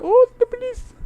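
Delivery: very high, loud, panicked squeal; panicked Roy impression. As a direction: shriek it like a terrified Roy from The IT Crowd. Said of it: "Roy's like".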